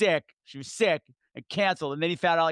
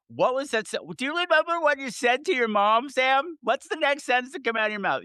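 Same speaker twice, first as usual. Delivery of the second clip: high-pitched, pressing interrogation; insistent questioning; 9 semitones higher than usual. Retold or imitated. Game confronting Sam about the last call to mom, insistent. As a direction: press for the answer, pitch raised.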